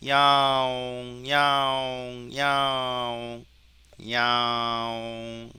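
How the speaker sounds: long-held meows on one level note; cat meow imitation. Shown as a demonstration. Teacher testing the cat detection, imitated meowing. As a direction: meow slowly, stretching the vowel. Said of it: "고양이 한번 해볼까요".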